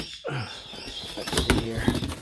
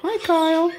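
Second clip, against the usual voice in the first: much higher, louder coo on Hi; 14 semitones higher, 5 dB louder than usual. Real, not acted. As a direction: squeal the greeting. Greets same bird twice.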